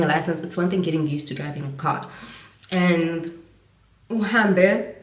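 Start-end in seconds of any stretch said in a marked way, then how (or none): none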